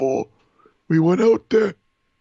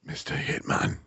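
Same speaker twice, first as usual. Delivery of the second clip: whisper; 6 dB quieter than usual. Retold or imitated. Imitating the whispered voicemail.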